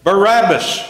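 loud, high cry with a falling end; crowd shout. A narrator's reading. Demonstrators demand a prisoner from Pilate, shouting. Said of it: "The demonstrators shouted".

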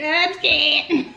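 nervous laugh through the words; half-laughing scared. Real, not acted.

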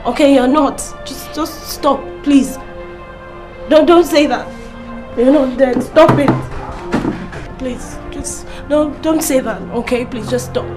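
tearful pleading. Acted. Pleading with dying Ken.

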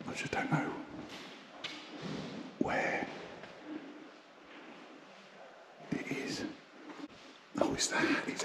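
soft unvoiced whisper. Real, not acted. Searching the church pews, still whispering. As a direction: keep it hushed as if in a church. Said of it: "Why am I whispering?".

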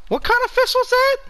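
very high, fast squeal of disbelief; high-pitched excited repeat. Real, not acted. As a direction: shriek the question in pure excitement. Repeats question, much higher.

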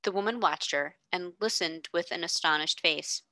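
ordinary delivery

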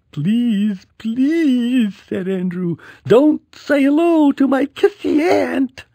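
high, whiny pleading. A narrator's reading. Boy begging mom to avoid the aunt, high whine. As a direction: beg in a high kid voice.

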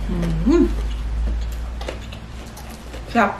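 chewing while speaking; closed-mouth hum while eating; mouth full. Real, not acted. Seen 0: Food put in mouth at -2.0; cheeks full, lips closed while chewing.

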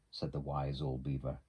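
usual speaking voice

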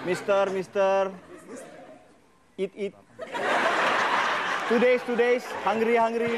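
mock pleading beggar; a hammed-up beggar calling to a foreigner in broken English; begging tourist.

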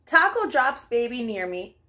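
plain reading voice

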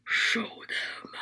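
breathy unvoiced whisper of a disembodied voice; eerie whisper. Film voice heard in the dark, retold: eerie whisper. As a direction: whisper it like a ghostly command from nowhere. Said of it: "and then a voice says".

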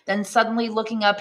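usual speaking voice

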